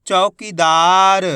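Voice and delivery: "चौकीदार" joined up, slow and loud, long "दा"; blended word, drawn out. Shown as a demonstration. Teacher joins the letters for learners, "चौकीदार" as one drawn-out word. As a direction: run "चौकीदार" together, stretching the vowels. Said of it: "तो मिलके बन जाएगा".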